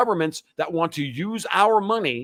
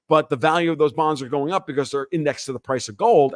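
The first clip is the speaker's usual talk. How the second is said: normal talking voice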